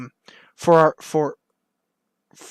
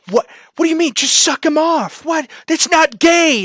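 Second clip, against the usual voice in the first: indignant protest; 9 semitones higher, 3 dB louder than usual. Retold or imitated. Skit crowd objecting to the guy saying no, indignant. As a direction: play a scandalized crowd, pitched way up.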